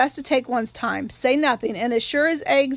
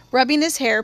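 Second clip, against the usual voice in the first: plain reading voice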